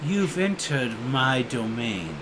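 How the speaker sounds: low, slow dragon warning; low dragon warning to the intruders. Acted. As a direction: claim the sky as your own, slowly. He goes.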